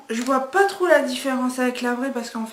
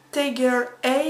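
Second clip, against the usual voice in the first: English accent. Real, not acted. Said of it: "excusez-moi pour mon accent anglais".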